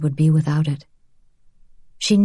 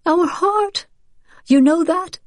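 high, tearful crying voice; 13 semitones higher than usual. A narrator's reading. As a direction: speak through tears. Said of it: "She was crying now".

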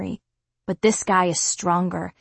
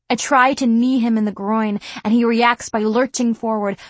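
ordinary delivery

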